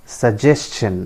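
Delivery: suggestion repeated clearly as su-JES-chun; correct pronunciation. Shown as a demonstration. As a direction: model suggestion with S and CH. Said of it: "I repeat". (suggestion का सही उच्चारण, स-जेस-चन)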